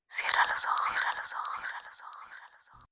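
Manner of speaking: hushed, dying into silence; fading whisper. Acted. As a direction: whisper fading away to nothing.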